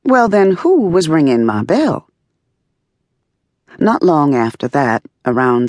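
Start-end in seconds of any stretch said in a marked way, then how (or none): none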